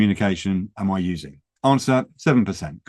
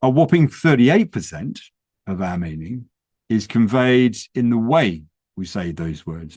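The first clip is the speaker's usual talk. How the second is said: ordinary talk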